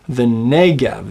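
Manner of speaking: Negev slow, with NEH drawn out; stress on first syllable. Shown as a demonstration. Teacher confirming the answer, Negev slowly stressed on NEH. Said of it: "The accent falls on the first syllable".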